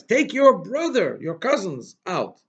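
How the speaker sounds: слова «brother» и «cousins» выделены, медленно и громко; emphasis on brother. Shown as a demonstration. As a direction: stress "Take your brother, your cousins out" slowly, leaning on brother. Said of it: "Why does he have to emphasize".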